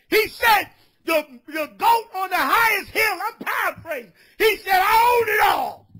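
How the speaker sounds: shouted, high street sermon; fiery preaching. Acted. Street preacher scolding a brother, shouted preaching. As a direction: preach it full force at the crowd.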